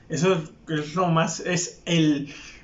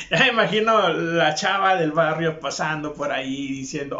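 ordinary delivery

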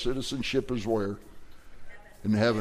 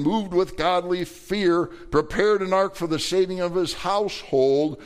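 scripture reading; 6 semitones higher, 3 dB louder than usual. Acted. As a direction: read it out to the congregation like holy writ. Reads scripture verse aloud.

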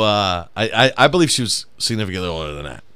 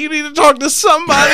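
laughing mock shout; 7 dB louder, faster than usual. Mock quote amid laughter.

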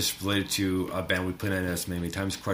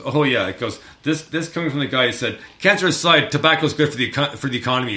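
loud, higher mock-Niles retort; a hint of a snooty sitcom jab; quoting Niles; 5 semitones higher, 7 dB louder than usual. Fan retelling a Frasier joke, louder and higher. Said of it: "He goes".

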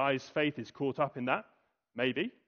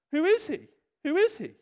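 indignant scribes; 12 semitones higher, 4 dB louder than usual. Acted.

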